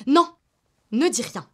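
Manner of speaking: loud, sudden cut-off; emphatic, high-pitched "non" to silence someone; firm interruption. Acted. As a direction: shut them up forcefully. Cuts off partner from replying.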